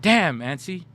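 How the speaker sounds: high-pitched cheeky retort; retold comeback. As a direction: roast auntie back in mock amazement. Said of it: "and I go".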